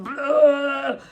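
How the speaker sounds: loud, silly mock rant; mock blackout ranting. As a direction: blurt it out like a bursting, bellowing caricature. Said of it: "just comes like".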